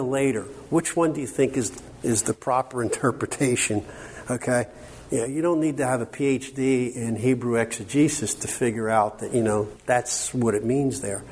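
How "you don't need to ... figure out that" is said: ordinary talk, nothing marked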